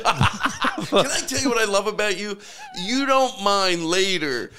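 high, amused, through laughter; laughing. Real, not acted. Host to a friend caught fibbing, laughing. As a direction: say it while still laughing. Amused after a confessed bit.

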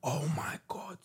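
breathless whisper; whispered oh my God. Real, not acted. Friends share trip news, a stunned whisper. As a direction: whisper it in disbelief.

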